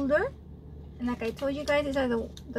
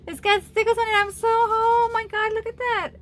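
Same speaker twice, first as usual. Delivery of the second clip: excited; 9 semitones higher, 7 dB louder than usual. Real, not acted. Gushing over a glittery doll.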